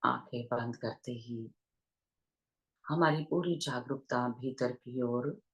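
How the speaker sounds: unhurried meditation guidance with long pauses; guided meditation. Real, not acted. Teacher leading online listeners into breath awareness, guided meditation voice. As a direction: guide listeners gently into their breath, leaving long silences.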